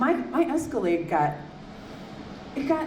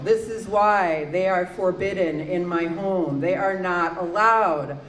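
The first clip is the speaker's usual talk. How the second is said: slow, weighty, spaced-out words; low, measured exasperation; emphatic pronouncement; 4 semitones lower, slower than usual. Acted. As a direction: lay down the law slowly. Spelling out the house ban.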